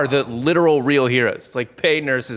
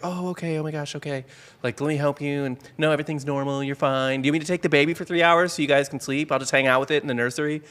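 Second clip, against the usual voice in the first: soft, higher, kind nurse voice; caring nurse; 3 semitones higher, 3 dB quieter than usual. Retold or imitated. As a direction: gently reassure like a sweet nurse. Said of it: "the nurse will be in there".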